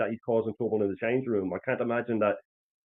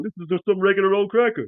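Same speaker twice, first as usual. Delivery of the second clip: high-pitched mock letdown; lightly acted disillusion, still pitched up; Eddie Murphy; 9 semitones higher, 4 dB louder than usual. No longer starving, judging the cracker, lightly mocked disappointment. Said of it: "that thing with Eddie Murphy".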